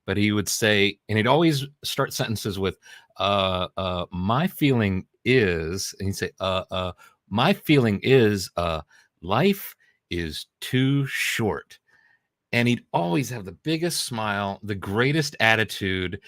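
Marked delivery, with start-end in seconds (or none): 3.2-6.0 slow, halting hesitation on each uh; imitating a stutter. Friend recalls his coworker's stutter, slow halting imitation. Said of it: "and this is how he said it". 6.4-11.8 slow, halting hesitation on each uh; imitating a stutter. Friend recalls his coworker's stutter, slow halting imitation. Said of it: "and this is how he said it".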